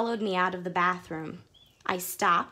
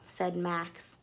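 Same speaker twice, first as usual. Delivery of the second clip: plain reading voice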